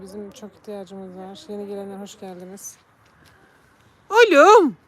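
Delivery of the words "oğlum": loud high call. Real, not acted. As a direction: call him from far off. Calling the cat. (yüksek sesle seslenme)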